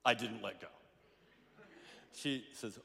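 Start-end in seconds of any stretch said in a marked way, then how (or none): none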